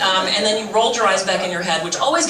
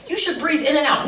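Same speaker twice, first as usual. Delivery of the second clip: higher-pitched mocking know-it-all; mock preachy advice; 7 semitones higher than usual. Comedian mimics people telling her to meditate, mocking. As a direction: parrot unwanted advice, high-pitched.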